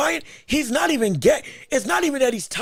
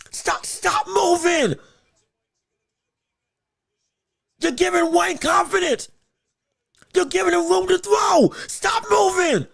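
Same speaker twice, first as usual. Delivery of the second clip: 1 exasperated shout; 5 semitones higher than usual. Real, not acted. Begging fighter to stop moving.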